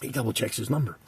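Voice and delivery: usual speaking voice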